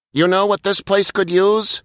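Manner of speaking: light, high robot voice; a chipper little robot with a bright idea; robot Huggo voice. A narrator's reading. Huggo suggesting to Muggo, lightly voiced robot. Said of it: "Huggo said".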